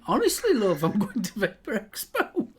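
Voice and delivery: fib to the wife while laughing; laughing mock excuse. Husband fibbing to his wife about the expo, laughing.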